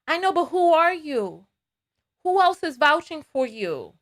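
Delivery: ordinary talk, nothing marked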